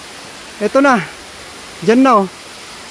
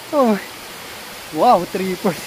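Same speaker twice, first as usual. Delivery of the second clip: ordinary delivery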